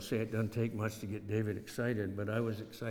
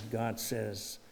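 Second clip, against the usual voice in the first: ordinary delivery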